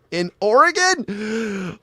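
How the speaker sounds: high, drawn-out incredulous question; amused disbelief. Real, not acted. Astonished at listener's comment.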